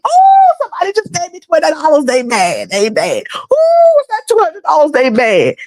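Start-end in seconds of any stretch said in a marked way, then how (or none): 0.0-2.0 shrieking high "Oh" then fast gloating; mock televangelist squeal; mocking preacher. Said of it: "mocking preacher". 3.4-4.9 shrieking high "Oh" then fast gloating; mock televangelist squeal; mocking preacher. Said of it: "mocking preacher".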